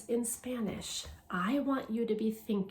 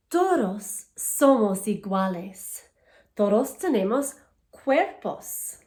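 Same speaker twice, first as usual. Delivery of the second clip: louder, clear storybook reading to a class; reading to children; 4 semitones higher, 8 dB louder than usual. Acted. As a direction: read each page out to the kids, bright and paced. Reads Spanish picture book aloud.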